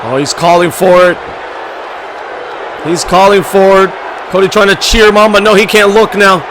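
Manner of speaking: loud, driving ringside commentary; hyped play-by-play. Acted. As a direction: call the match like an arena announcer. Live wrestling match commentary.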